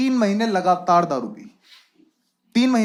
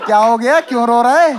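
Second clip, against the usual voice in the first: mock concern; 6 dB louder than usual. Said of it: "मैं कहा".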